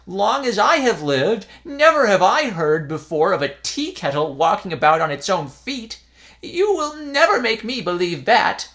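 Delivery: put-on high, loud dismissive old man; scolding old priest. A narrator's reading. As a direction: scold the silly boys.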